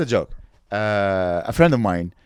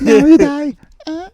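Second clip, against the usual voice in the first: high and much louder hammed-up yell; comic stabbing villain yelling the kill; mock killer yell; 12 dB louder, slower than usual. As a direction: bellow it like a cartoon villain.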